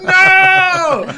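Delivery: very high, loud, held "no"; hammed-up scream of dismay; panicked mock wail. High friend learns he's tripping alone, panicked wail. Acted horror at friend's confession.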